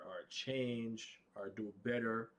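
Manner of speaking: ordinary delivery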